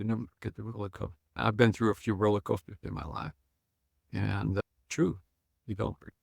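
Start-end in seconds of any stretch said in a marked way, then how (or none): 0.0-1.2 quiet fast repeat. Shown as a demonstration. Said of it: "what I tell people is".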